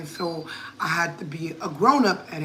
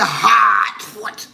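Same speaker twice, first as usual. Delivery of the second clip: slow Hartford accent with Hartford said as "Hahtfut"; 6 dB louder than usual. Shown as a demonstration. Grandma showing the listener how Hartford locals talk, in the Hartford accent. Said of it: "that's how they talk".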